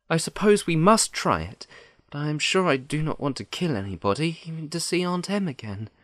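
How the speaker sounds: put-on high, plaintive little-girl voice; reluctant Dorothy. Dorothy agrees to try after crying, reluctant.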